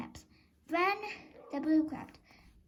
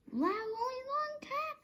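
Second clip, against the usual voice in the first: surprised; 12 semitones higher than usual. Acted. Peddler wakes, finds caps gone.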